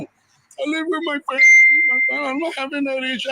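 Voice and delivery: high-pitched mocking quote; mocking imitation. Father ridiculing the other man's family talk, high mocking voice. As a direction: throw his words back in a high mimicking voice.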